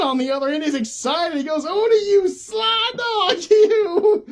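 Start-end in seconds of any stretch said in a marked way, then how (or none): 1.6-4.3 high, slow, laughing delight; delighted John. Retold or imitated. John cheers Odie for stowing away, high and laughing. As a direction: stretch it out, thrilled and chuckling.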